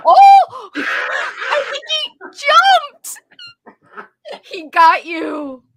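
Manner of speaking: loud, high startled gasp that drops to a gasp. Real, not acted. Host startled as the dog jumps out. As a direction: jump in real fright.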